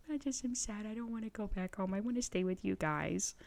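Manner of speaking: retold tearful sadness. Daughter retelling her tearful goodbye to parents, small sad voice. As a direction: imitate a sad, crying kid who wants to stay. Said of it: "started crying".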